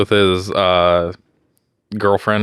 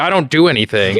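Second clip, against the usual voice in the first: imitating an offended partner, pitched up; wife's hurt reply; 6 semitones higher than usual. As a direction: play the upset wife. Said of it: "And I'm, or, like".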